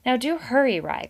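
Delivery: a mother hurrying her son off; urgent. A narrator's reading. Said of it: "It's urgent".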